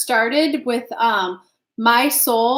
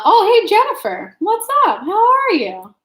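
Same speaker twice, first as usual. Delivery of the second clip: excited greeting; 7 semitones higher than usual. Real, not acted. Vlogger spotting Jennifer in chat, excited and high. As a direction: squeal a surprised hello.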